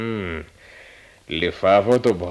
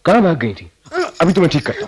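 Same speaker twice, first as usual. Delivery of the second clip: louder, higher teasing threat; playful mock threat; 7 semitones higher, 6 dB louder, much faster than usual. Husband playfully catching his wife, mock threat. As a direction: pretend to scold her, grinning.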